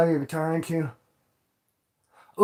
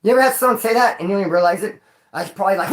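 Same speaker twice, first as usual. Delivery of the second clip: ordinary delivery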